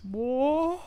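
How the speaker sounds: high, rising "what"; drawn-out Hank Hill-like exclamation; Luis. Retold or imitated. Trying out Luis's voice for a friend, exaggerated high impression. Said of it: "he sounds like Hank Hill".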